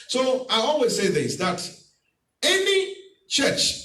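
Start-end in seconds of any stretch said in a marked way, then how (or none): 2.4-3.7 emphatic preaching. Real, not acted.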